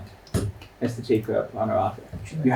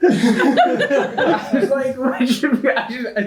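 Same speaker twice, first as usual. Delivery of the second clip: high, breaking up with real laughter; laughing; 12 semitones higher, 3 dB louder, slower than usual. Real, not acted. Player explaining the joke to the table, laughing helplessly. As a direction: struggle to get words out through giggles.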